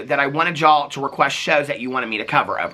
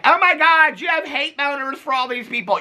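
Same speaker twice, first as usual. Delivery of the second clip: mock shocked viewer; 11 semitones higher than usual. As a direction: play a scandalised viewer. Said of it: "are like".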